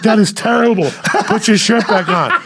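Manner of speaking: loud, high mock disgust; appalled onlookers. Onlookers ordering him to cover up, mock horror. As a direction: cry out as the horrified crowd.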